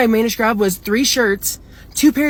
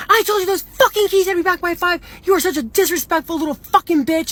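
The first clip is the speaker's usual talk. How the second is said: imitated furious scolding; 8 semitones higher than usual. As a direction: mimic her telling you off in a high, strained rant. Retold furious scolding over keys.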